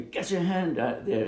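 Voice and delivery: urgent warning cry, held back; retold scream. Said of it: "Louis Mowbray screaming at me".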